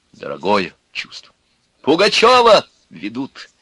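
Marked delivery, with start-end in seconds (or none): 1.8-3.5 high, loud street cry; free, full-voiced holler; shouting. A narrator's reading. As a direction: proclaim it to passers-by. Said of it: "кричал он".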